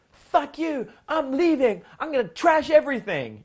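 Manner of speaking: high, loud bratty tantrum voice; hammed-up screaming child; mock child tantrum. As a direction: play a screaming five-year-old. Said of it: "when a five-year-old girl is like".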